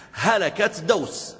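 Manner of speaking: high and very slow, drawn out in dread; هلكت دوس drawn out high and slow in mock dread; dramatic dread. Shown as a demonstration. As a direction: stretch it out like doom is coming. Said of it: "repeats for effect".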